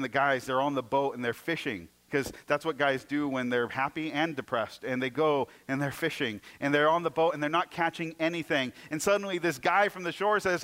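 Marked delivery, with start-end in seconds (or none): none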